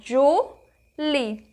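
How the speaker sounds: "Julie" split into Ju and lie with a pause; syllable by syllable. Shown as a demonstration. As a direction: pronounce "Julie" as two separate drawn-out syllables. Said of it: "quando eu pronuncio o meu nome". ("Julie" dito em duas sílabas separadas)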